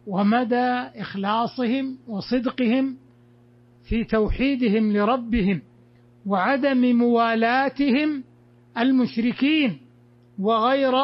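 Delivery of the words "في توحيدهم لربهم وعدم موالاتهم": usual speaking voice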